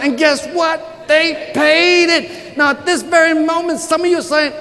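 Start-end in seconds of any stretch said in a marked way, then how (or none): 0.0-2.6 slow triumphant reveal. Acted. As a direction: hammer home the payoff slowly. Repeated punchline reveal.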